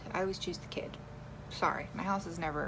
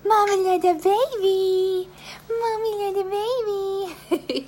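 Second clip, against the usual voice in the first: high, loud, slow cooing; 11 semitones higher, 8 dB louder, much slower than usual. Real, not acted. Cooing at baby.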